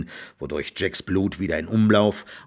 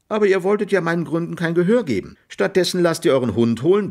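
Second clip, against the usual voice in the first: lecturing; 8 semitones higher, faster than usual. A narrator's reading. Jack lectures the farmer.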